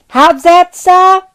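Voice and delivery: put-on high, bright young lad's voice; a cocky boy calling up to the window; cheeky street boy. Street boy answers Scrooge.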